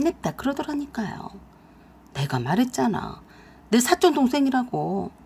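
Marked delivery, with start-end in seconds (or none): none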